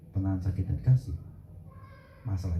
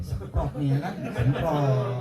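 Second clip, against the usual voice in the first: laughing; 6 semitones higher, slower than usual. Real, not acted. Jokes while laughing.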